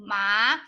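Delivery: MA lifted at the end like a question; second tone. Shown as a demonstration. Teacher models second tone for students, rising. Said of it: "This is the second tone".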